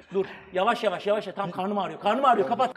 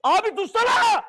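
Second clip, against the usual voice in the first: screaming while falling; 11 semitones higher, 4 dB louder than usual. Real, not acted. Begging to stop the pain.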